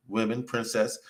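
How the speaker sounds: ordinary talk, nothing marked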